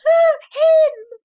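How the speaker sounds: put-on very high, little boy crying out; child's wail. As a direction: wail like a terrified small boy. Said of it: "replied the child".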